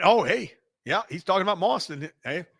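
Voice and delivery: ordinary talk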